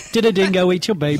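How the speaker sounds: hammed-up Australian caricature; mock Australian accent. Co-host teasing the reader's slip, mock Australian.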